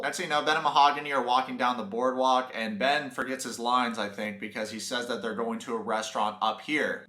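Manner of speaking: ordinary delivery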